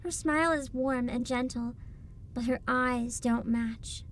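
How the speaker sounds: calm, even narrator voice; story narration. A narrator's reading. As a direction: read it like a storybook. Reading game narration.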